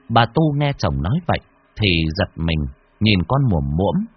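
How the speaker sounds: plain reading voice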